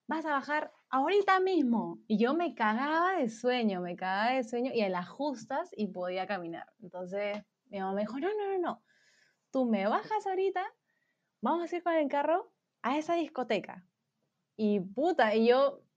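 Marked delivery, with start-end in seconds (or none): 0.1-2.0 higher-pitched imitated order; bossy mother. Mother orders drunk daughter downstairs, imitated stern command. 8.2-13.8 high-pitched, insistent mock ordering; bossy mother. Mother orders daughter to the car, high bossy imitation.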